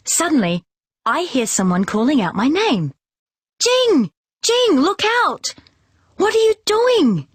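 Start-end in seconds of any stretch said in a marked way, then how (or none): 3.6-7.3 much higher, louder warning shout; urgent yell. A narrator's reading. Ali yells at Jing who isn't watching the game. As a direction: yell a warning to a daydreaming friend. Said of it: "yells Ali".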